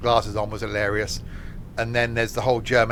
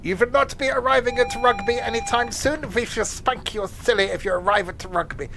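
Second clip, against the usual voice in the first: much higher, hammed-up German accent; a failed stab at a stern German accent; bad German accent; 11 semitones higher than usual. Streamer tries a German accent on the announcement, hammed-up German accent. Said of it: "Can I do my German accent?".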